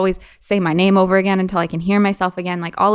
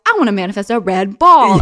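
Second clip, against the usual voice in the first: put-on louder kid voice wishing; twelve-year-old; 8 dB louder than usual. Twelve-year-old girl making a wish, louder kid voice. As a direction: play a determined child. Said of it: "I'm, like, twelve being like".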